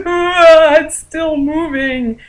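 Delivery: high, drawn-out squeamish cry; horrified wail. Retold or imitated. Looking in the bag at living bug, horrified. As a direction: stretch the words in mock horror.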